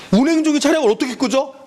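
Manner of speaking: lightly voiced student with a high-pitched question; nervous student. Pupils puzzle over the exam item, lightly mimicked nervous student. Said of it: "애들이 근데 막 긴장해".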